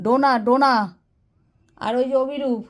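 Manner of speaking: loud name calling; to someone hard of hearing. Real, not acted. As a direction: call the name out clearly.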